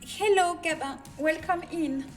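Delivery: ordinary talk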